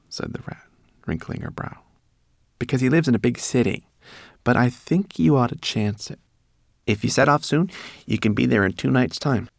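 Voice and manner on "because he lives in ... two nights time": higher, louder rat voice; busy little rat giving counsel; rat, advising. A narrator's reading. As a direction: advise firmly, pitched up.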